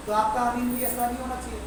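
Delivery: ordinary talk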